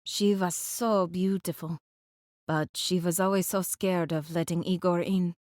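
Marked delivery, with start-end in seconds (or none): none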